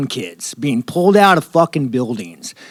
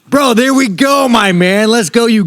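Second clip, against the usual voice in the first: bursts out high and loud, delighted; hyped, grinning "bro, there we go"; excited; 7 semitones higher, 4 dB louder than usual. Real, not acted. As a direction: jump on it like the best question yet. Thrilled by the mustache question.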